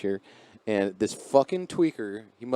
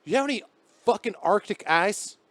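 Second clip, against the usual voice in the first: ape the twitchy guy's demand; mock tweaker asking for ice; 8 semitones higher than usual. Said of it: "he goes".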